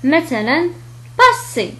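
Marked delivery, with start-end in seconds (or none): 1.2-1.7 "باسي" with voiceless s in the middle; higher-pitched "باسي", middle sound a clear s; double S as seen. Shown as a demonstration. Teacher showing double S, clear s in "باسي". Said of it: "ينطق كذلك كحرف السين".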